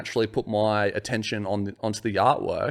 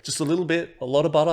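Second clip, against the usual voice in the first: lightly voiced helpful giver, higher pitch; a hint of put-on careful advice; mock instruction; 7 semitones higher, faster than usual. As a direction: imitate someone coaching a newcomer. Acting out a Vegemite giver's advice.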